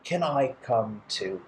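ordinary delivery, nothing marked

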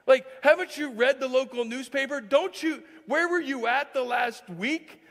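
loud, high, incredulous demand; worked-up, exasperated challenge; angry outburst. Acted. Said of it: "could have been one of anger".

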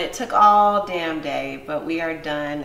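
loud triumphant announcement; excited. Real, not acted. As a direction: shout it out, thrilled it's done. Finished ribs, triumphant reveal.

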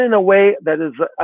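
usual speaking voice

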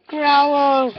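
a play growl to make the dog growl back; mock growl. Shown as a demonstration. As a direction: growl the word at the dog to set him off. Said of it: "I can make him growl, watch".

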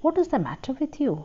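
a husband alarmed at his wife's tears; concerned. A narrator's reading. Husband worried as his wife starts crying, concerned. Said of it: "concerned as she began to cry".

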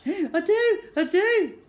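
high, loud wheezy protest; insistent whining child. Acted. Boy contradicting his dad about asthma, whining loudly. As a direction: protest it in a squeal.

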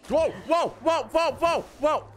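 high, rapid-fire yelps; real startled high-pitched outcry; startled alarm. Real, not acted. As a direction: yelp each word in a high burst. Startled by something in the game.

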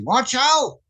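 high, urgent "Watch out!"; mock yell of warning; retold warning shout. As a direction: friends yelling a warning from the back seat. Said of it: "they yelled out to me".

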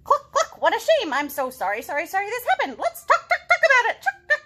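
high, loud clucking chicken; high squawky chicken chatter. Acted. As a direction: flap about like a fussy hen. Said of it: "the chicken responds".

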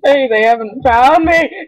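ordinary talk

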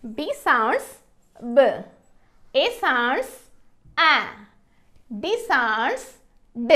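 "B", "A", "D" as short isolated sounds; swooping "sounds", then each short phonic after a pause; phonics sounding-out. Shown as a demonstration. Teacher sounding out B, A, D for learners, each sound short and separate. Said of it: "ఎలా పలుకుతుందో చూద్దాము".

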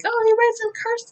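very high cooing delight; gushing. Retold or imitated. Fan reacts to name on letter, gushing. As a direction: coo over it in a high voice.

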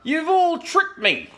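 very high, loud wail of betrayal; anguished howl. A narrator's reading. Dingo accuses the animals, howling. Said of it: "he howled".

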